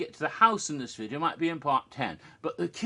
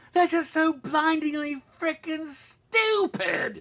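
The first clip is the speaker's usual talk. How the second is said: higher, drawn-out, slow exasperated rant; exasperated outburst; 10 semitones higher, slower than usual. Real, not acted. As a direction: spit out the frustration, stretching each word. Ranting about dumb movie characters.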